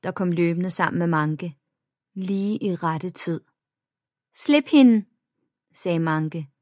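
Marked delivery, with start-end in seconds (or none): 4.3-5.0 stern order. A narrator's reading. Friend orders bully to let go.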